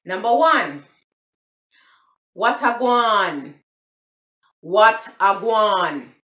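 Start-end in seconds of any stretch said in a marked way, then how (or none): 2.3-6.1 slow, emphatic Jamaican patois phrase. Shown as a demonstration. Vlogger teaching viewers patois phrase number one, Jamaican patois. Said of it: "That's the Jamaican patois".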